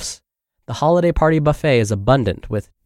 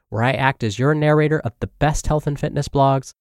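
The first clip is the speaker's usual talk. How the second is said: ordinary delivery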